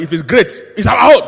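loud, rising claim of ownership; mimicking an eager crowd, loudly; proud public claim. Mimics public claiming government property.

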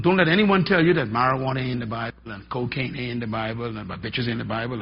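normal talking voice

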